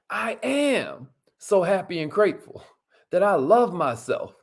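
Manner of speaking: mean every word of it; affirmation reading. Shown as a demonstration. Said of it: "Say it like you mean it".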